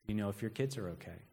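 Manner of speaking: low, soft, quick creepy voice; breathy creepy caller. Acted. Creepy caller phoning yet again, breathy and low. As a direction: murmur it low and breathy, with menace. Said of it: "It's a breathy voice".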